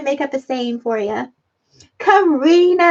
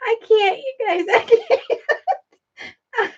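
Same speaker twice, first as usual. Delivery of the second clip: high, helpless, words giving out; too overcome by laughter to go on; crying with laughter; laughing so hard; 7 semitones higher than usual. Real, not acted. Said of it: "I'm laughing so hard".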